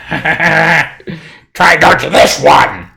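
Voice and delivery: high, loud, drawn-out goblin taunt; taunting redcap. Acted. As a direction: shout a slow, menacing challenge as a nasty little creature. Redcap taunts before attacking.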